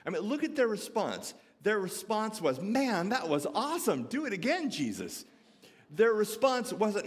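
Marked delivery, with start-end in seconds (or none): 2.6-5.3 high, hammed-up fan enthusiasm; mock excitement. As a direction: cheer him on like an impressed fan. Mock excited cheer disciples didn't give.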